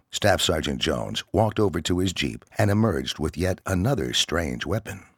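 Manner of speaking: usual speaking voice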